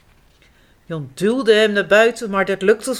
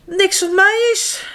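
lively storybook reading to little ones; reading to children. A narrator's reading. Reading a picture book aloud to little kids. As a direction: read it like bedtime for toddlers.

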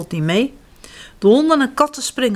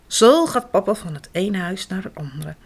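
usual speaking voice

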